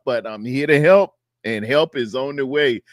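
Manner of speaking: ordinary talk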